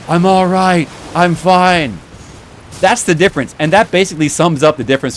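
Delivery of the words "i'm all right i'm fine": higher, louder, lightly put-on voice; mock a drawn-out melodramatic recovery; Frodo, belated reassurance. Fan mocking movie Frodo minutes after stabbing, belated reassurance. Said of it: "three minutes later, he's like".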